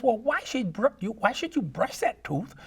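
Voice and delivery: high, scolding granny falsetto; cartoon granny selling sausage; Granny Loomis. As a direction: wag a finger like a sly old grandma. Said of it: "She says".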